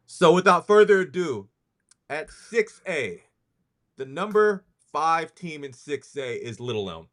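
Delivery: rankings announcer. Acted. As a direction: announce it like a big reveal, pausing for suspense.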